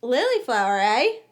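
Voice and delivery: smug teasing call; cocky. A narrator's reading. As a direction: say it like a cocky schoolboy. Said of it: "a rather cocky voice said".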